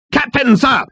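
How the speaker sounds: high-pitched military shout; crying out. A narrator's reading. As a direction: report to the captain at parade-ground pitch. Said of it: "cried number two".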